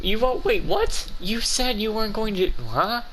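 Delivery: disbelief. Real, not acted. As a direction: voice jumps high in stunned disbelief. Writing team forgot Lumine's promise.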